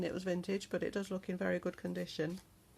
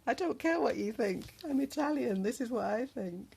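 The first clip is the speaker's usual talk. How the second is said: higher, louder, hammed-up Italian accent; 6 semitones higher, 7 dB louder than usual. Joking to viewers in a loud, hammed-up Italian accent. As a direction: play a brash Italian who doesn't care what you think. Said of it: "The Italian accent makes me think of".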